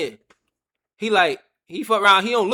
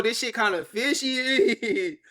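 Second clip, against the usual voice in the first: slow suspicious drawl; 5 semitones higher, much slower than usual. Retold or imitated. Retold suspicion at the door.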